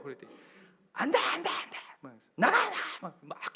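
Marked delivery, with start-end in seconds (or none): 0.9-3.0 imitating a grandmother shrieking very high and loud; angry shooing. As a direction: chase him out with a high angry yell. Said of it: "쫓아내더래요".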